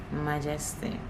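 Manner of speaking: normal talking voice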